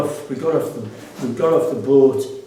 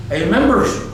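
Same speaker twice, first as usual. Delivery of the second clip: doorman's challenge; 6 semitones higher, 6 dB louder than usual. Retold or imitated. Doorman challenges them, voiced.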